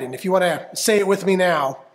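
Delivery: plain reading voice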